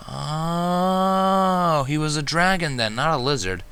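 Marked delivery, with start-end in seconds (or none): none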